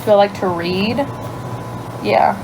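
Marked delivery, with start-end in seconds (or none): none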